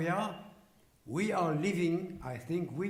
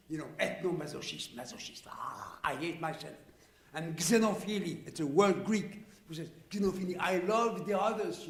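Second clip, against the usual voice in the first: ordinary delivery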